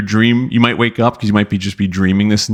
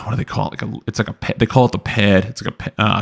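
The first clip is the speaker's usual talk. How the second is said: ordinary talk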